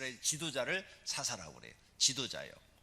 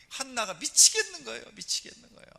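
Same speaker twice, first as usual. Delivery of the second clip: exasperated outburst; 12 semitones higher, 9 dB louder than usual. Retold or imitated. Hannah driven to despair.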